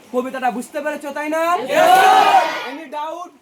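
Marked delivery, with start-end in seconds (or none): 1.5-3.3 loud drawn-out question. Real, not acted. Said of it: "checks class understanding".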